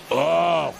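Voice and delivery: usual speaking voice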